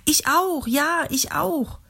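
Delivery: a second voice chiming in eagerly; mehrere Stimmen rufen. A narrator's reading. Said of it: "Ganz viele riefen das". (eine viel höhere, langsame Stimme aus der Menge)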